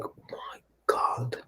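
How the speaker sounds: choked whispered my God; hushed, moved disbelief; awed whisper. Retold or imitated. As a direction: breathe it out, near tears. Awed reaction to doctor's words.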